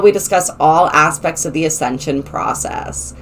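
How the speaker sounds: normal talking voice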